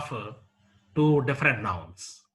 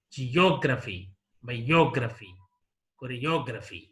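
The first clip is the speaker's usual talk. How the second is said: "geography", "biography", "choreography" with OG stressed, ending on a fall; antepenultimate stress; 3 semitones lower than usual. Shown as a demonstration. Teacher repeating examples, "geography", "biography", "choreography" stressed on OG. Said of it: "on the antepenultimate syllable".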